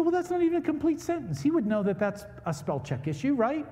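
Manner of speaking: high, loud indignant reasoning; indignant disbelief. Retold or imitated. Preacher arguing with wife about spell check, high and loud. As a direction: insist it's obviously a typo. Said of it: "I said".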